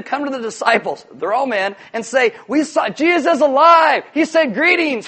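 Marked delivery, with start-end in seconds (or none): 2.5-5.1 high, loud, breathless-with-news cry; excited announcement. Acted. Women telling disciples Jesus is alive, excited shouting. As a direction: shout it out like astonishing good news.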